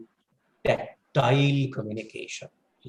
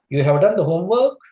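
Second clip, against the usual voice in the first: "you have done the homework" turned into a question, climbing high on "homework"; louder, with the pitch sweeping up through "homework"; statement word order asked as a question, lifting sharply at "homework"; rising tone; 6 semitones higher, 8 dB louder than usual. Shown as a demonstration. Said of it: "uttered this sentence with a rising tone".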